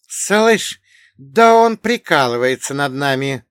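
loud, high disbelief; taken aback. Acted. Armed intruder to his companions, loudly taken aback. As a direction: blurt it out high, incredulous. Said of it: "опешил один из парней".

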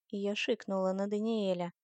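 ordinary delivery, nothing marked

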